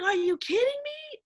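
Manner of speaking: high, squeaky replay of her own disbelief; a high pitched "are you kidding me"; stunned disbelief. Retold or imitated. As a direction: act out being floored by the news.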